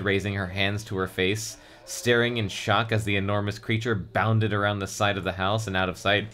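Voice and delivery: ordinary delivery, nothing marked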